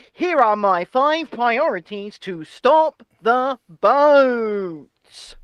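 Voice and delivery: very slow, drawn-out politician's delivery; Rishi Sunak. Acted. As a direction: stretch each word with grave self-importance. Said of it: "let me do my best Rishi impression".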